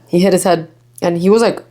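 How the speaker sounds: ordinary delivery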